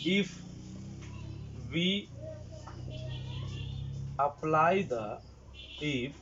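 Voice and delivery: measured, word-by-word dictation with long pauses; slow dictation. Real, not acted. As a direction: dictate in short chunks for note-takers. Dictates notes for students to write.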